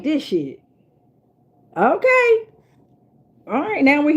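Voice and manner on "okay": high, drawn-out "okay" of surprise; surprised okay. Real, not acted. Reading tarot, reacting to the cards with a high okay. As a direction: pop way up as if caught off guard. Discovering the next tarot card.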